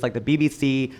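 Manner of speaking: usual speaking voice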